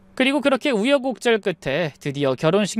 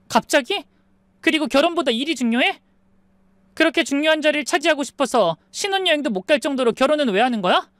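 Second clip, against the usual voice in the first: raised, higher-pitched angry protest; 8 semitones higher than usual. A narrator's reading. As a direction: argue back in a mildly shouted, high voice. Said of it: "소리를 지르니까". (높은 목소리로 따지듯 언성 높여)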